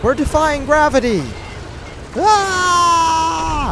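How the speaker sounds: high thrilled yell; excited shout. Real, not acted. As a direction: shout it with glee. Wild cutscene.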